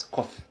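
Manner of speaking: "coth" as one short word, TH at the end; pronunciation demo. Shown as a demonstration. Teacher repeating how you pronounce it, said distinctly. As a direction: finish "coth" on a "th" sound. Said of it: "You pronounce it as".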